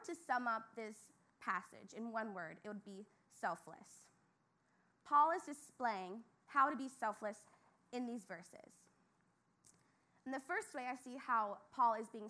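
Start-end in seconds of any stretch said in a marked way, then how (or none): none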